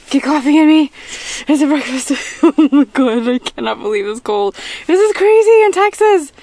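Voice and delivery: loud, high laughing disbelief; excited disbelief. Real, not acted. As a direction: exclaim it, half laughing. Excited about cold.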